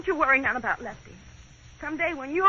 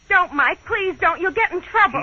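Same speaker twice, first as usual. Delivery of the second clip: louder, higher urgent plea; anxious pleading; 5 semitones higher, 7 dB louder than usual. Acted. Wife to husband storming out, anxious loud pleading. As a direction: beg him to stop.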